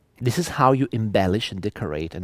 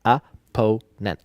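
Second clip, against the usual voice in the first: Opponent split slowly, o-PO-nent; slow, separated "Opponent" with second-syllable stress; syllable-by-syllable demo; 4 dB louder, much slower than usual. Shown as a demonstration. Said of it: "You stress the second one".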